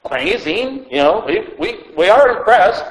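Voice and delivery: ordinary delivery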